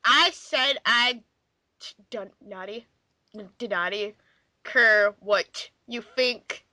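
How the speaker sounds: usual speaking voice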